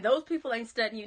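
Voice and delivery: Southern accent slipping out. Real, not acted. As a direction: say it with Southern sass, a bit loud. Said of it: "I said it too in the Southern voice".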